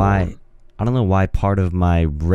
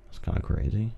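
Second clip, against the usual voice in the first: whispered; 11 dB quieter, faster than usual. Real, not acted.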